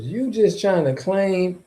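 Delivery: ordinary talk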